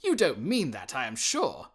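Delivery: put-on bright, loud young nephew, merry and teasing; a loud, cheery young man's voice; cheerful nephew. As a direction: laugh it off to the grumpy uncle.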